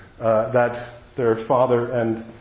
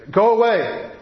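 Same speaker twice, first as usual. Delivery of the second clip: go away; 7 semitones higher, slower than usual. Retold or imitated. Grieving father behind door.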